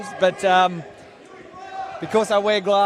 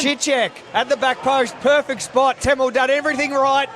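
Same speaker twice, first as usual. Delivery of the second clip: excited commentary; 7 semitones higher, 4 dB louder than usual. Real, not acted. Co-commentator reacting to near miss.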